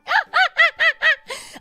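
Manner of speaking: loud, high pulsing laugh; real laugh. Real, not acted. Streamer at own bone joke, high loud laugh.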